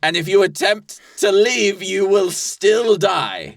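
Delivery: hammed-up villain offering a deal; menacing soul dealer. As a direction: lay out the deadly bargain slowly and theatrically. Plays deal-maker in improv scene.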